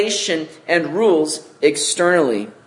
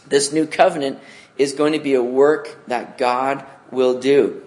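ordinary talk

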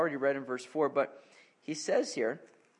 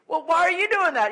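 much higher, much louder scolding; exasperated dad; 14 semitones higher, 13 dB louder than usual. Retold or imitated. Dad turning on the other kid, loud exasperated scolding. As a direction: wheel round on the other kid, fed up. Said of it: "And I'm like".

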